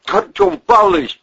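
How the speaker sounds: high, loud imploring cry; pleading. A narrator's reading. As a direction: beg the boss to stop. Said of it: "взмолился бандура".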